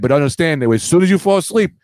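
mock threat. Mock threat voiced to a date.

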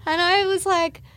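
laughing. Real, not acted. Laughing while retelling.

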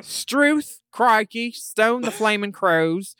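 mock Australian exclamations; Australian accent. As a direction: lay the outback accent on thick. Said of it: "so here's some accent help".

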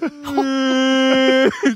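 high drawn-out mock cry; long mock wail. Kindergartner watching mom leave as he lines up, imitated long wail. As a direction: let out one long imitation bawl. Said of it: "just lets a long one go".

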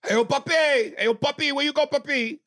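loud, high-pitched calling out; imitated street caller. Puerto Rican caller hailing papi on the street, shouted high. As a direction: holler it high down the block.

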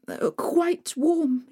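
stuttering with cold. Acted. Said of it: "she managed to stutter".

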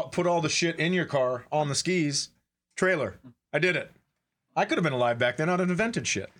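ordinary talk

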